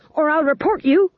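a shrill shouted threat. Acted. The fool threatens the guards, shouting. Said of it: "he shouted".

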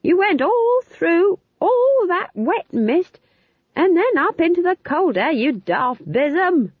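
very high, stretched-out scolding; slow, high-pitched indignant shout. A narrator's reading. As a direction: yell the telling-off over the wind. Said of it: "she shouted".

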